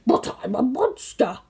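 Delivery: monster protest. Acted.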